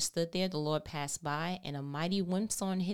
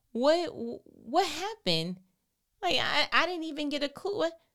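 mock bewilderment; 10 semitones higher, 6 dB louder than usual. Preacher mimics confusion.

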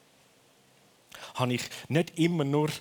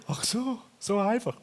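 mock relief; 10 semitones higher than usual. Relief once Jesus brings light.

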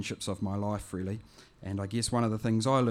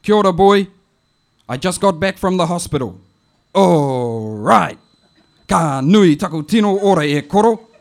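louder, bossy auntie message; louder auntie voice giving instructions; auntie on the phone; 5 semitones higher, 14 dB louder, slower than usual. Retold or imitated. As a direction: auntie laying down orders over the phone. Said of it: "it goes like this".

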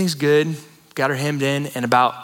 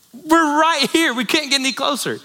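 exasperated dad; 9 semitones higher than usual. Retold or imitated.

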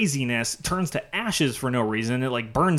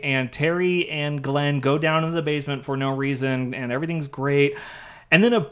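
normal talking voice

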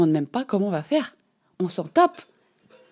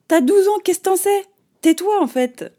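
much higher, louder exasperated retort; indignant outburst; 11 semitones higher, 7 dB louder than usual. Real, not acted. Telling off the twelve-year-old who crushed her dream, loud and much higher. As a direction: fire it back at her, fed up.

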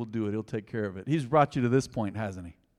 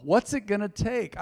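higher-pitched, insistent question; urgent challenge; 6 semitones higher than usual. Real, not acted. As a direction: press them for a wake-up call. Urging a wake-up call.

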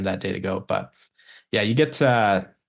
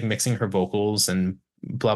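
normal talking voice

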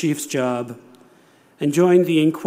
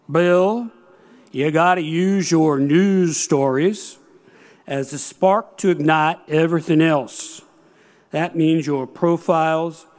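slow, stretched North Carolinian drawl; imitated Southern drawl from North Carolina. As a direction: draw the words out like a North Carolina editor. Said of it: "and forgive the drawl".

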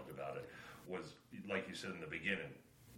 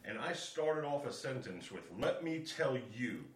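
louder, higher mock swagger; mock defiant entrance; 7 semitones higher, 7 dB louder than usual. Father acting out barging into his parents' room loudly. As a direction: ham up the kid barging in.

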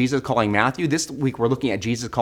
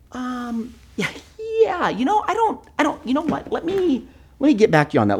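hesitant stalling; 12 semitones higher than usual. Retold or imitated. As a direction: stall nervously. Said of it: "And you're like".

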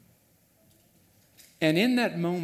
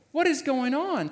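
bewildered disciples; 9 semitones higher, 4 dB louder than usual. Acted. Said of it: "they're all staring at Jesus now".

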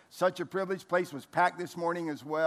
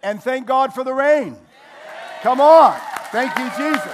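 much louder, higher praise shout; joyful praise; 8 semitones higher, 14 dB louder than usual. Real, not acted. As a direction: cry out thanks with full joy. Praising the rain.

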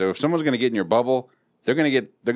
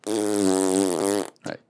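usual speaking voice

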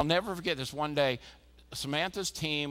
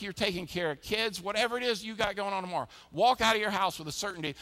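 ordinary talk

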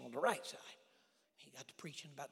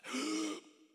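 sharp gasp; 11 semitones higher, 6 dB louder than usual. Retold or imitated. Being dunked in the tank.

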